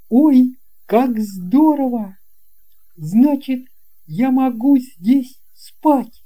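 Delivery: put-on a thrilled little boy asking if he may sleep there; boy's voice. Boy delighted. (высокий восторженный мальчишеский голос)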